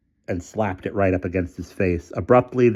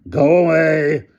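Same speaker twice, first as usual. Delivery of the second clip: higher, louder, slow shout; desperate megaphone shout; 9 semitones higher, 8 dB louder, slower than usual. A narrator's reading. Hamilton desperately shouting at the crowd over the megaphone. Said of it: "shouted Hamilton desperately over the megaphone".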